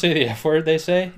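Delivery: usual speaking voice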